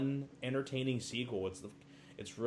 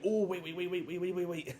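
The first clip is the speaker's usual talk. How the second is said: higher, frantic string of waits; hammed-up flustered begging; mock panicked pleading; 7 semitones higher than usual. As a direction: imitate a man scrambling to stop him. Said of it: "he's like".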